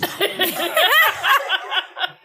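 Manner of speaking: high cackling laugh; shrill giggle. Real, not acted. Laughs after her name.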